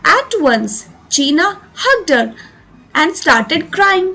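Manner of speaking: slow, deliberate reading aloud for pupils; slow story reading. A narrator's reading. Teacher reading the story line to students, slow and clear. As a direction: read each word out clearly and slowly.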